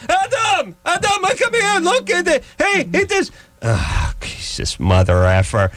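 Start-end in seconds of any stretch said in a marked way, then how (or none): 0.1-3.3 much higher put-on voice of a pestering neighbor lady; neighbor woman calling. Neighbor lady summoning Adam to look, in a much higher put-on voice. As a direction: squeal for Adam like a nosy neighbor.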